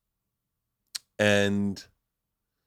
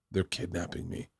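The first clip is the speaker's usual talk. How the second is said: soft, low little aside; quiet mock confiding; 4 semitones lower, 11 dB quieter than usual. Daughter to airport security lady, quiet and low. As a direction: imitate a child quietly telling on someone.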